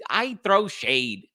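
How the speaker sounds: high, slow, owning it proudly; sassy declaration. Real, not acted. Proudly telling viewers he throws shade, sassy and slow. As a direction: draw out I throw shade with attitude. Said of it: "That's what I do".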